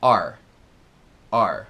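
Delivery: are stretched into two syllables like "ah-er"; are said like hour, "ah-er". Shown as a demonstration. Teacher showing the lazy American are, two syllables. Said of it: "como se fosse A R E".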